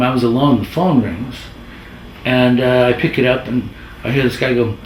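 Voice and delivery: ordinary talk, nothing marked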